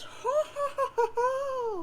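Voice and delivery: high, slow witchy ho-ho laugh; old hag cackle. Acted. Nana laughing off John's question with a high witchy cackle. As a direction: cackle like a gleeful crone. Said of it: "And she goes".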